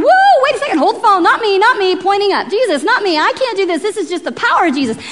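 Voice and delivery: high, loud alarmed protest; acted frantic disclaimer, pitched well up; freaking out. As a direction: wave off the praise in a panic. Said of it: "Paul's freaking out".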